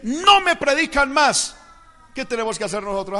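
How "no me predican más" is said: loud, slow, high-pitched order; stern prohibition. Acted. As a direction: lay down the law as an acted authority. Acted government order banning preaching.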